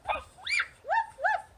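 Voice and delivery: high little puppy woofs; two yappy play barks. Acted. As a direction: bark like an excited little puppy. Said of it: "is a little puppy".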